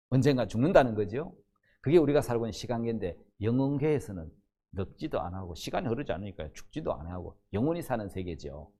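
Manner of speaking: ordinary delivery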